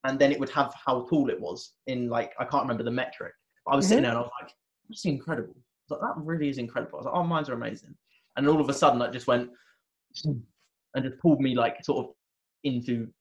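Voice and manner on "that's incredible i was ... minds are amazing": awed wonder. Retold or imitated. Awed by his own vision.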